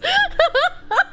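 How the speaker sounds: short burst of high laughter; amused laughter. Real, not acted. Laughs at chat message.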